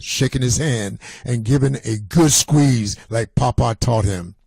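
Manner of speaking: ordinary delivery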